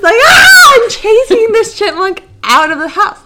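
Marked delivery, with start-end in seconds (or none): 0.2-0.7 high, loud battle scream. Retold or imitated. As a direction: scream to chase it out. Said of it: "literally screaming at the top of my lungs".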